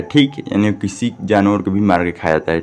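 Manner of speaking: ordinary delivery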